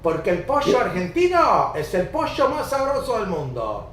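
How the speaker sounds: playful rioplatense voseo; Argentinian accent. Retold or imitated. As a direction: sing it out like a porteño. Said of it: "how the Argentinians talk".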